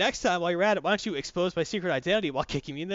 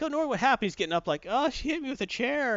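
higher, lightly voiced exchange; mock characters; 9 semitones higher than usual. Heroes and Normie talking, higher mock voices. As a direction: squeak it out in a raised voice. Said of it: "It's like".

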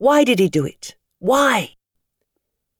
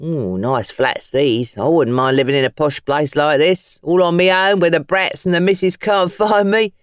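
admiring cabbie. Acted. Cabbie admiring Tom's flats, joking about his missus. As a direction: savour the posh flats like a cheeky driver. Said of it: "whistled with appreciation".

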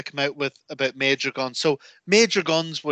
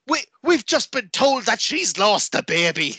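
mock announcer; 7 semitones higher than usual. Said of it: "suddenly it would be".

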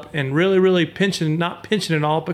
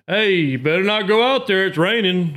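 grandpa warning; 4 semitones higher than usual. Retold or imitated. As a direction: scold like a grumpy grandpa. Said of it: "it's kinda like your grandpa".

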